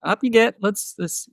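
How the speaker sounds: put-on jolly a fallen toddler back up; calm reassuring parent. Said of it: "the other parent just goes".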